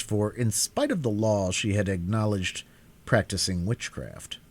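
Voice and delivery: plain reading voice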